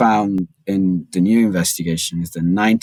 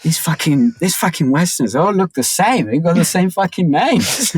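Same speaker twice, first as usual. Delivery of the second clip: mock exasperated agent; 10 semitones higher than usual. Agents complaining about Westerners.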